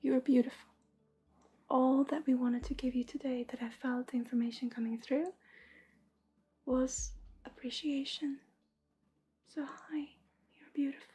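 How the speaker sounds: hushed, tender reading voice, half whispered; soft channelled message. Acted. Reader telling a viewer she is worthy, in a soft half whisper. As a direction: breathe the words to her gently like a blessing.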